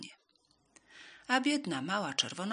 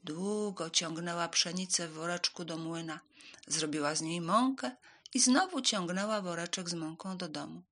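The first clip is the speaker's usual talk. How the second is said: ordinary delivery, nothing marked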